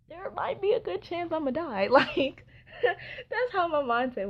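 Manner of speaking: high laughing disbelief. Real, not acted. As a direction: laugh through the words. Laughing at own fear.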